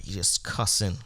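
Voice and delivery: ordinary talk, nothing marked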